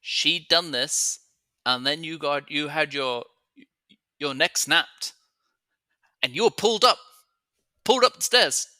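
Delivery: from insistent to near shout; urgent building. Real, not acted. Player urgently recounts the death.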